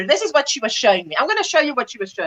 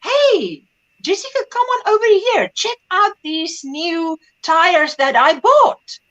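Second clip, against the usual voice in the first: higher, drawn-out enthusiastic calling; proud father; 5 semitones higher, slower than usual. Retold or imitated. Father calling Jessica to see tires, proud. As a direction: imitate a dad showing off his purchase. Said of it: "your father calls you and he says".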